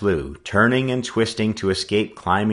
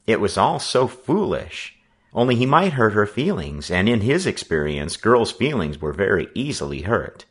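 usual speaking voice

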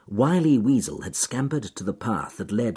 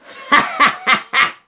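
shrill gleeful villain laugh; high villain cackle; 9 dB louder than usual. Acted. Fox laughing at scheme.

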